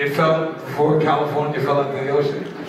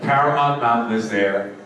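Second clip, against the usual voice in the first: ordinary talk, nothing marked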